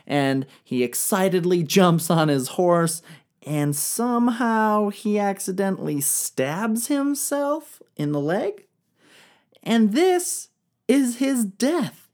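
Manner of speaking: normal talking voice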